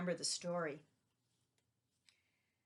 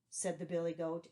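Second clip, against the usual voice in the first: ordinary delivery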